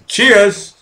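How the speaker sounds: loud, high toast; cheers. Real, not acted. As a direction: raise the glass and call it out. Toasting the chat loudly.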